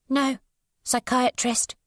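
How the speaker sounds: put-on a withdrawn child speaking in fragments; voiced odd young girl. A narrator's reading.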